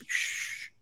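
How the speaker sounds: playful hushing "shh"; mock shush. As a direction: shush them as if sharing a secret. Mock hush about a secret.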